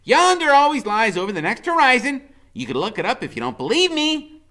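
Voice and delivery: high, loud, patient and lordly; a boy knight humoring his steed; Freak's voice. A narrator's reading. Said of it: "Freak pats me on the head and says".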